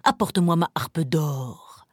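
low commanding ogre; a lightly voiced giant giving orders; lazy ogre barking at his wife; ogre's order. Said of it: "l'ogre ordonna".

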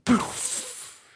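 soft airy plop into water; splash noise. A narrator's reading. Duck diving into the pond, splash sound. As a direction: puff out a whispered splash. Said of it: "fit le canard en plongeant".

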